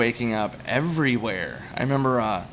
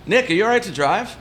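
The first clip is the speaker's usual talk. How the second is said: higher-pitched jokey question; teasing, pitched-up fake check-in; joking mock concern; 9 semitones higher, 3 dB louder than usual. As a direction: imitate a friend asking as a joke. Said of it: "Like, as a joke".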